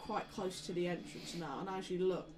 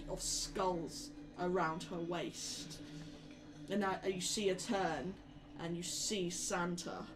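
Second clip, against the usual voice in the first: usual speaking voice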